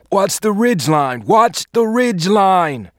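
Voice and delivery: slow, high yelled order across the field; battle shout. Acted. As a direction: bellow the warning over gunfire. Sergeant yelling during firefight.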